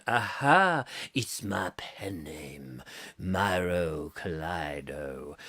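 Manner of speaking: very low, slow mystery-man voice; mysterious character voice. Acted. Performer unveiling a pen name, mock-mysterious. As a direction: draw it out low, slow and quiet.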